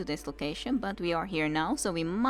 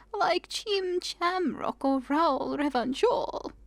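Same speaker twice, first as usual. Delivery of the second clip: put-on old woman voice; 7 semitones higher than usual. Old woman explains joke.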